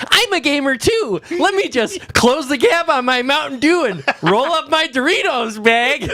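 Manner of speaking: high, chirpy put-on voice; mock gamer-girl host. Mocking the BlizzCon host girl, high chirpy voice. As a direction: play a fake relatable gamer. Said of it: "the chick who's just like".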